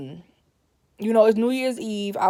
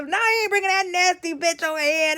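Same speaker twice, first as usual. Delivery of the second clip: grandma refusing; 8 semitones higher than usual. Retold or imitated.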